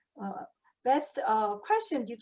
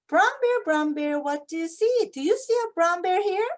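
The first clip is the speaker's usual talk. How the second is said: put-on read-aloud to kids; 4 semitones higher, 4 dB louder than usual. Shown as a demonstration. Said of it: "teacher reading book aloud to class".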